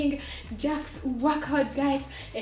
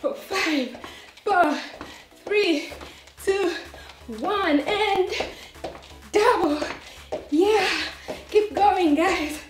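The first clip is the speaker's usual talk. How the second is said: workout coach; 4 semitones higher, slower than usual. Acted. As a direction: pump up the class like a coach. Loud workout countdown.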